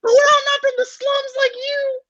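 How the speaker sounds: very high, slow, sneering; high-pitched screaming-style voice. Acted. Demon insulting Barrett, in a very high sneering voice. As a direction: sneer it in a shrill demon squeal. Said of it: "your high pitched screaming voice".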